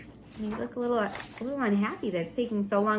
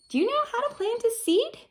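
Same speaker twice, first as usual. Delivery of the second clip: high question; 13 semitones higher than usual. Acted.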